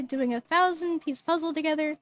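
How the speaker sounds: ordinary delivery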